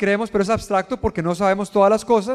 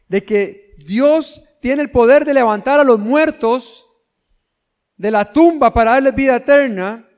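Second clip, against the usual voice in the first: fervent preaching; 3 semitones higher than usual. Acted. Preacher urging believers to trust God, fervent. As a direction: lean into the promise of resurrection.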